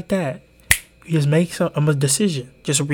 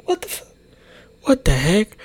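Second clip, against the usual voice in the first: shocked outrage; 7 semitones higher than usual. Retold or imitated. Retold shock at the coach's insult.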